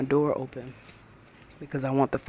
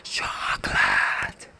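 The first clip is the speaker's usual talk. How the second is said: slow, much lower, breathy whisper; whispered cartoon quote; 6 dB louder, much slower than usual. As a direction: hush the word like a cartoon guy craving it. Quoting SpongeBob episode "Chocolate.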